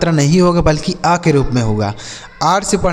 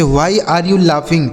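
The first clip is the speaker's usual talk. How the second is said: each word said very slowly and clearly; slow clear English; much slower than usual. Shown as a demonstration. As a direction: dictate it slowly for learners. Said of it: "जैसे". (बहुत धीरे, साफ़ अंग्रेज़ी उच्चारण)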